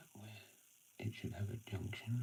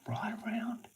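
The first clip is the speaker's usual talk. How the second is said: high, loud call across the paddock; a high, loud dog command; 13 semitones higher, 6 dB louder than usual. Real, not acted. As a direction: send the dog around with a sharp call.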